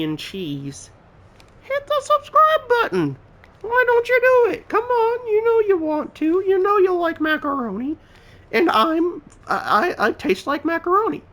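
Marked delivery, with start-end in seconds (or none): 1.6-8.0 cajoling. Pretend. Begging viewers to subscribe, falsetto. 8.5-11.2 cajoling. Pretend. High silly voice continues.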